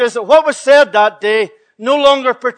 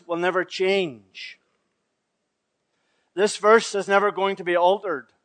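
hushed solemn preaching; 4 semitones lower, 7 dB quieter than usual. Acted. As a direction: lay each word down softly and gravely. Solemn declaration of scripture.

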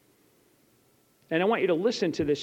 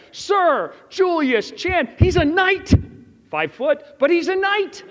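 much higher and louder, slow and amazed; amazed laughing exclamation; 9 semitones higher, 7 dB louder than usual. Real, not acted. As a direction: marvel at it, half laughing. Marvelling at meeting a knight.

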